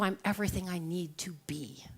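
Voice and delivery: soft breathy whisper of self-belief; whispered affirmation. Acted. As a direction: breathe it out like a secret promise.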